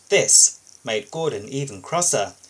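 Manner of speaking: usual speaking voice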